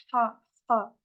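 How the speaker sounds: "ha" with strong throat friction, repeated; Arabic rasped ha. Shown as a demonstration. Teacher showing how Arabs say it, rasped "ha". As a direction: force the breath through a tight throat on "ha". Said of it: "Il y a que les Arabes qui vont dire".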